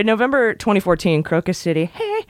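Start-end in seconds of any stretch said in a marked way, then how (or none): 1.8-2.3 high soft sung hey; excited shout. Pretend. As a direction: yelp like a rock chorus. Song shout again.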